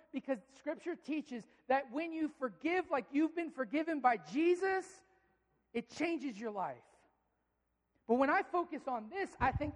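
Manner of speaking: ordinary talk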